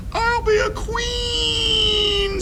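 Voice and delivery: put-on very high, slow, loud regal child voice; grand little queen. Joan announces her costume, high, slow and grand. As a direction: stretch it out like a girl playing queen.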